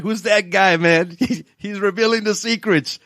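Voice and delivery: lightly mock an indignant advisor; half-hearted outraged insider voice; annoyed financial advisor. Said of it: "You'd be like".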